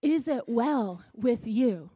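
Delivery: "Is it well with you" drawn out slowly; emphatic, probing. Shown as a demonstration. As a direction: stretch IS, WELL and YOU, word by word. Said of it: "We're going deeper here".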